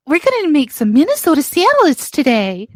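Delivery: high singsong Minnesota impression; Minnesota salads lady. Quoting a favorite online lady to a friend, high Minnesota mimicry. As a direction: imitate the salad lady, pitched well up. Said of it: "She's like".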